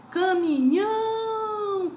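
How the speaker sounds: Caminhão with nhão held long, high and loud; exaggerated final stress. Shown as a demonstration. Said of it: "com a sílaba mais forte".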